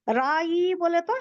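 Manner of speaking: normal talking voice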